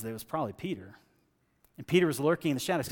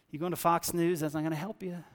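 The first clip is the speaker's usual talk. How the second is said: ordinary talk, nothing marked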